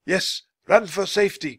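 high, urgent call. A narrator's reading. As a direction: call back a warning. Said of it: "called Basho".

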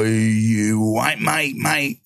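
very slow, lightly done Cockney drawl; Cockney fella. Retold or imitated. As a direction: draw out mate like a slurring Cockney bloke. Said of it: "Cockney fella come over and he went".

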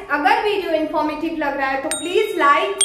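ordinary delivery

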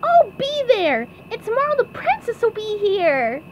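high, excited cartoon call, easing off in volume; SpongeBob shouting. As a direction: cheer it in a squeaky pipsqueak voice. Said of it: "SpongeBob shouted".

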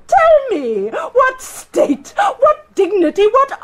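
high, cracking, mocking questions; mock his offer through grief; bitter mockery. Queen mocks Richard's promises, bitter and cracking.

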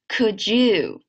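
"Could you" blended like "could-joo"; linked words. Shown as a demonstration. Teacher repeating for students, words joined. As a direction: merge "Could you" into one word. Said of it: "连起来".

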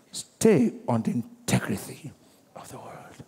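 soft, breathy whispered counsel. Acted. Preacher urges believer to stand firm, in a whisper. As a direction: lean in and whisper the charge.

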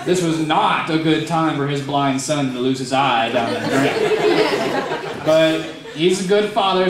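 ordinary delivery

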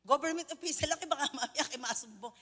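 normal talking voice

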